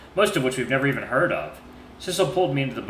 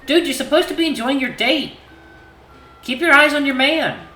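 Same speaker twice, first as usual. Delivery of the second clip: higher, louder bossy sister; 14 semitones higher, 7 dB louder than usual. Acted. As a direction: nag him playfully. Voices Ginny texting.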